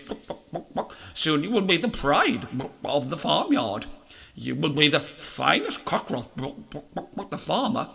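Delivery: hushed hen clucks, very soft; clucking mother hens. A narrator's reading. Doting hens whisper praise to their sons, softly clucking. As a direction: coo flattering clucks into the chick's ear. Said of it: "whisper in their ears".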